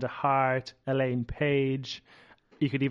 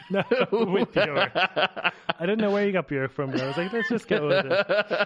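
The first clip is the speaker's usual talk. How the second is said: laughing; 5 semitones higher than usual. Real, not acted. Laughing at the mix-up.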